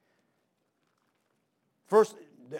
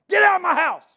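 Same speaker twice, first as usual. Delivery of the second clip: much higher, much louder furious shout; angry yell; 12 semitones higher, 14 dB louder than usual. Retold or imitated. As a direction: roar him out of the house. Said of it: "He's yelling like that".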